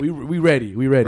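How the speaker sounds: playful retold bravado. Cashier assuring customer the register is sanitized, playful bravado. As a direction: declare it with mock swagger.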